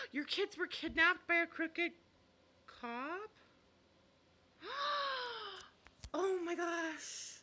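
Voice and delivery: gasps, then a high, loud, stunned question; real shock, breaking into a slow cry of dismay; horrified gasp at a kidnapping story. Real, not acted. Reading a shocking viewer comment.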